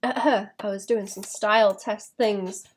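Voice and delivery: normal talking voice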